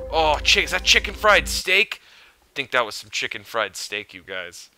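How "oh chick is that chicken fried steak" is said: excited. Real, not acted. Recognizing food in game.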